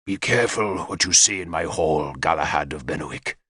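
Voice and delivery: low, menacing growl; growled warning. A narrator's reading. King warning Galahad at sword point, low growl. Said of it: "Gorfethid growled".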